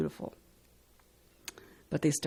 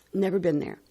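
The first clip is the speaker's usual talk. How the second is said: normal talking voice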